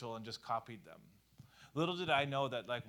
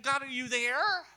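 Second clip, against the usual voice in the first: high, loud pleading call; plaintive high call; plaintive call to God; 12 semitones higher, 10 dB louder than usual. Retold or imitated. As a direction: cry out uncertainly to God. Voicing a doubting prayer.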